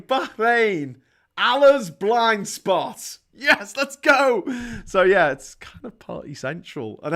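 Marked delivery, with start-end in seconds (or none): 0.0-3.2 big, loud, drawn-out slogan; higher, louder mock advertising pitch; mock tourist slogan. 3.3-4.4 high, loud, drawn-out cheer; hammed-up rallying cry; mock excited cheer. Mock cheer after sign joke.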